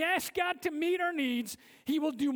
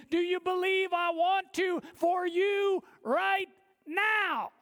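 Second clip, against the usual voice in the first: Jesus rebuking doubt with fervour; Jesus answering; 5 semitones higher than usual. Retold or imitated. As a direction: build from disbelief to a fervent cry. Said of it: "And Jesus is like".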